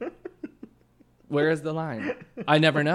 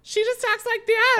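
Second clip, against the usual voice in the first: very high, loud doll voice; Heaven; 9 dB louder than usual. Retold or imitated. Host imitates friend's voice, high-pitched doll. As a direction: squeak it out like a talking doll. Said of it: "Heaven sounds like a doll".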